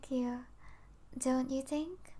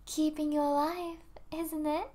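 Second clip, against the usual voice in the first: normal talking voice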